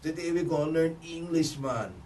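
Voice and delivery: put-on playful Jamaican accent, laid-back and friendly; thick Jamaican accent. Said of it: "his accent was thick".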